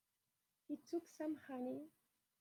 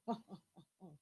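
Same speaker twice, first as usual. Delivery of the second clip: mock laugh; 4 semitones lower, much faster than usual. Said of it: "laughed Sid".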